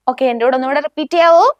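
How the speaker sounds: ordinary talk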